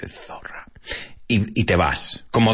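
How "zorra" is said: hushed, voiceless whisper; whispered insult. Acted. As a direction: drop the rude word to a whisper. Softens a vulgar translated insult.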